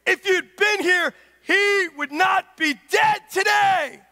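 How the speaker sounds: loud, high grieving cry; anguished plea. Acted. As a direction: cry out to Jesus in grief. Martha's grieving cry to Jesus.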